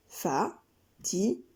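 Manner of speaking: Fa-ti-ma-ta said slow and soft in four beats; slow syllables. Shown as a demonstration. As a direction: step each syllable of Fa-ti-ma-ta. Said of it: "Quatre syllabes, quatre pas".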